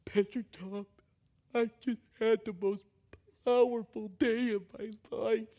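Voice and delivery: high-pitched mock sobbing voice; imitating a tough guy weeping; imitated crying. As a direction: tell it through put-on blubbering. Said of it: "he starts crying right there in the restaurant".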